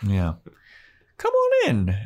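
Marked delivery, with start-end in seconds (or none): 1.2-2.0 very high welcoming mom voice; sing-song invitation from an eager mom; Joyce imitation.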